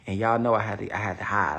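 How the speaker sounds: ordinary delivery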